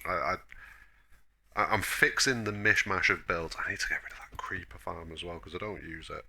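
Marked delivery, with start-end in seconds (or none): 3.6-6.2 whispered aside; whispering. Real, not acted. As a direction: drop to a breathy whisper. Quiet aside while building.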